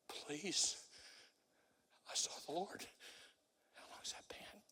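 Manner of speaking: soft, near-whispered begging; whispered altar-call plea; quiet pleading. Real, not acted. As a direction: beg them gently, barely above a breath.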